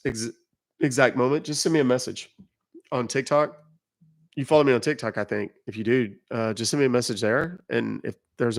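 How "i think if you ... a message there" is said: ordinary talk, nothing marked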